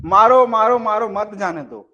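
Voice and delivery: repeated loud मारो, then मत जाने दो as one phrase; break after मारो. Shown as a demonstration. Teacher voicing a child's order, loud repeated मारो before मत जाने दो. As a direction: hit each मारो, keep मत with जाने दो. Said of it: "बच्चा अगर भाषा कौशल है और बोल रहा है".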